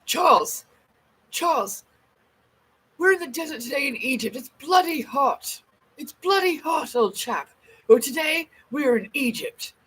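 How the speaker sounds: put-on old-fashioned British gentry abroad; posh old British accent. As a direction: put on a fancy old-time English accent. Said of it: "We used to fucking talk like that".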